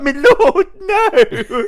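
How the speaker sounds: loud, high drawn-out mock wail; hammed-up pleading. Peasant sentenced to lashes protests to his lord, hammed-up wail. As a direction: cry out in comic despair through laughter.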